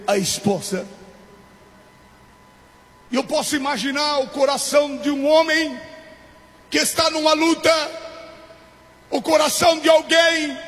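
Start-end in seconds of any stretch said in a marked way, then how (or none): 3.1-10.6 driving, insistent sermon delivery; impassioned preaching. Acted. Preacher imagining a suffering man's hope of comfort, impassioned preaching. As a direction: press on urgently in a raised preacher's voice.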